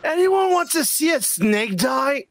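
slow, shouted villain taunt. Retold or imitated. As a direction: taunt it like a madman.

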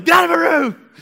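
high-pitched full shout; reenacted teenage bellow to get out; yelling. Son yells at mom from the shower, yelling. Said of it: "this is yelling".

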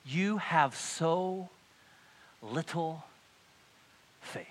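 very slow, spaced-out words; measured, weighty reproof; slow, deliberate. Acted. Jesus's reply, drawn out.

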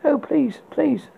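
high, half-sobbed begging; sobbing plea. A narrator's reading. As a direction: plead through mock tears. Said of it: "he sobbed".

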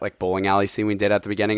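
usual speaking voice

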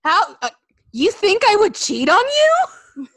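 mock indignant wife. Acted quarrel, wife's voice.

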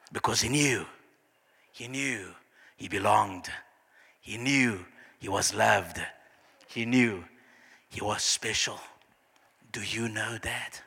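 low, soft, spaced-out refrain; hushed preaching. Real, not acted. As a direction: let each phrase sink in quietly, fading to a whisper.

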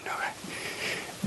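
whispered meek okay. Retold or imitated. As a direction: whisper it obediently. Meek reply to God.

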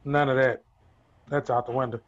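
ordinary talk, nothing marked

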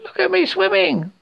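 very high, gleeful squeal; Roo's squeak. A narrator's reading. Roo answering mother while swept along, high squeak. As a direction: squeal it like a proud little animal.

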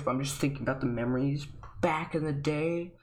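ordinary talk, nothing marked

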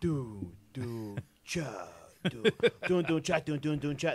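choppy, stuttering lag imitation; glitchy internet audio. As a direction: imitate a laggy Zoom call. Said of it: "I'd hear, like".

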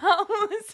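high burst of laughter. Real, not acted. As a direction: squeal of laughter. Laughs at own joke.

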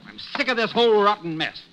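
husband exploding at wife; furious shout. Acted. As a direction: blow up at her.

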